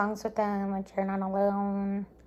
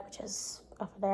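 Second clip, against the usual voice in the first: quick whispered aside; 9 dB quieter, faster than usual. Real, not acted. Aside about tote bag.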